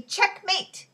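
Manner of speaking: gleeful victory cry; triumphant cry. A narrator's reading. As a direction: shout the win in triumph. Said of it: "he cried triumphantly".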